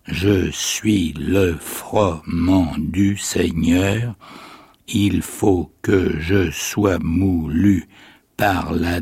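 very slow, each syllable spaced out; halting syllable reading. Acted. A little orphan reading the saint's life aloud, halting on each syllable. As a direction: read it like a schoolchild stopping at every syllable. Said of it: "qui lisait en s'arrêtant à chaque syllabe".

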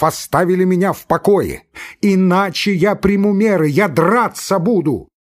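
high, loud raging threat; frantic shout. Acted. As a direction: lose control and threaten. Laevsky screaming at von Koren.

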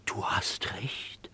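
a slow, awed whisper of realisation; stunned whisper. Acted. Gloster whispers to Henry as it dawns on him, hushed.

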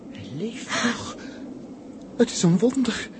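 amazed joy. Acted. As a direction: break out in astonished relief. Sees the fallen man move. (verbijsterd en opgetogen)

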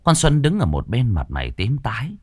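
usual speaking voice

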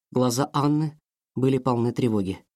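usual speaking voice

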